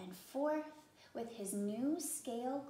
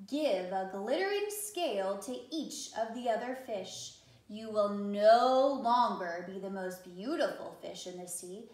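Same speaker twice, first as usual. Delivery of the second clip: deep octopus voice; 7 dB louder than usual. Acted. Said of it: "with a deep voice".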